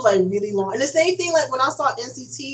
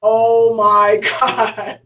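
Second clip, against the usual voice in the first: laughing oh my God; 5 dB louder, much slower than usual. Retold or imitated. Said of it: "I'm like".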